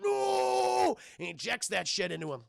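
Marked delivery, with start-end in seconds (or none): 0.0-1.0 high, loud, drawn-out no; mock anguished scream. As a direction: howl as if being injected. Imitates doctor being injected.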